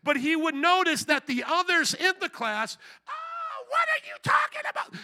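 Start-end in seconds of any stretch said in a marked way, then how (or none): 3.1-4.9 very high-pitched mock outburst; mock yelling. Classmates yelling at him in seminary, imitated high yell. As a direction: squeal it like outraged classmates. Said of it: "They would start yelling at me".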